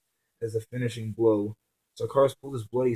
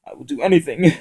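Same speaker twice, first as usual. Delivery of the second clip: higher, louder sniffling plea; tearful plea; 5 semitones higher, 10 dB louder, faster than usual. Acted. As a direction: offer anything through tears. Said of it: "The man sniffed".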